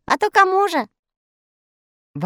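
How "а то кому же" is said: high, teasing elf voice; lightly voiced elf teasing; cheerful elf voice. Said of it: "засмеялся Скок". (весело, тонким голосом)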